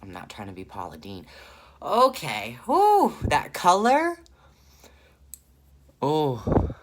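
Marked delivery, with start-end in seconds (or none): 1.8-6.7 high, loud and thrilled; excited whoop. Real, not acted. Gushing to viewers about the shade, high and loud. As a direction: squeal over the color.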